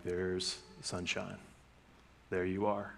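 soft, slow and low; tender greeting. Retold or imitated. Dad greeting his sleepy daughter softly at dawn. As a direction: greet her like the sun coming up.

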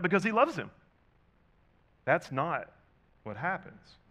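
loud and high on loves; emphatic. Real, not acted. Preacher stressing why Jesus would hurry, loud emphatic lift. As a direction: push loves up hard.

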